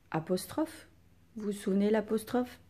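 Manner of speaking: ordinary talk, nothing marked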